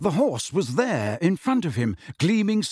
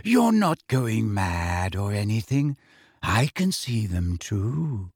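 slow, low, languid reassurance; faraway, unhurried girl; dreamy; 4 semitones lower, slower than usual. A narrator's reading. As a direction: let every word drift out dreamily. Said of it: "said a dreamy voice".

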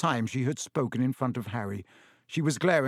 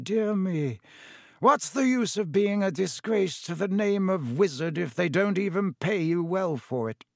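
higher-pitched, lofty sneering villain; Lucius Malfoy, disdainful; 5 semitones higher than usual. Acted. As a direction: feign pity to insult him. Lucius Malfoy taunts Weasley.

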